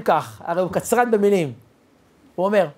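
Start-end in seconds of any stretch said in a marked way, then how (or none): none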